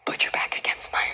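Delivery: whispered aside, barely audible; urgent whisper. Acted. Contestant secretly cues her sister mid-pitch, urgent whisper. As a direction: hiss the cue under your breath.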